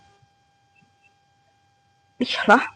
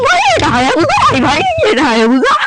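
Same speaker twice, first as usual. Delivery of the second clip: excited showing off; 13 semitones higher than usual. Real, not acted.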